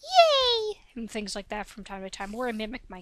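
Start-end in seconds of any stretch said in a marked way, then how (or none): 0.0-0.8 very high, loud squealed yay; squeaky excited yay. Shown as a demonstration. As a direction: let out a delighted squeal. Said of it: "my occasional accidental squeal".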